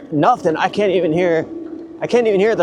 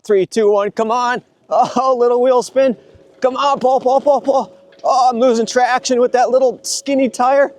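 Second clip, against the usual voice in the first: urging the bike uphill, straining; high, tense, pleading come on; tug of war; 8 semitones higher than usual. Real, not acted. Straining bike up a steep hill.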